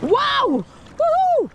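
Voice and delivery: high whooping cheer; excited. Real, not acted. Fisherman celebrating the catch, high excited whoop.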